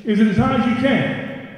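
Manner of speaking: loud and high, firing them up; hyping the crowd. Real, not acted. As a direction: pump up the room. Crowd call-and-response.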